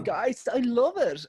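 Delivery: high, drawn-out gushing impression; Louis Walsh. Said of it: "And Louis's like".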